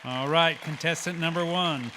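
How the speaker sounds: booming host call over the room; loud announcement. Real, not acted. Emcee to audience after the answer, loud call. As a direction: project it to the back of the hall.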